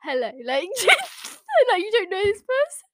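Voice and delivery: laughing through the words. Real, not acted. Girl laughing at her own cringe message, high-pitched. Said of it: "It's like".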